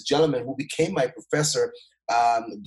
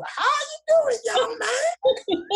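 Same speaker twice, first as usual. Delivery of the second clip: very high mock Southern old-lady drawl; Southern country drawl; much slower than usual. Old lady greeting a student in the hallway, imitated Southern drawl. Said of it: "this is Southern country drool kind of talk".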